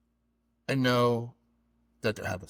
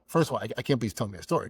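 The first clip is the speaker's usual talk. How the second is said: usual speaking voice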